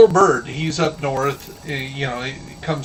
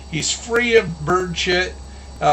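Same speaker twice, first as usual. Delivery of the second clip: ordinary talk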